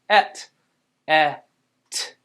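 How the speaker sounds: "et" whole, then "e" and "t" apart; short eh, pause, bare t; sounded out. Shown as a demonstration. Teacher gives second syllable, sounded out. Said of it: "Second syllable".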